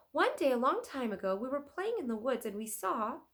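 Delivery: put-on high, loud eager girl; bright little girl launching a tale; eager Annie.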